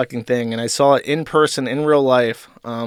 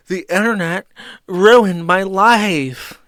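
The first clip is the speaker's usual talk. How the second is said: slow, higher, louder melodramatic line; dramatic line read; 7 semitones higher, 7 dB louder, much slower than usual. Retold or imitated. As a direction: ham it up like a TV tagline. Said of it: "It's like".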